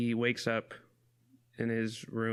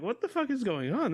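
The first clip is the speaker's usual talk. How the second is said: shocked; 12 semitones higher than usual. Retold or imitated. Retold shocked reaction.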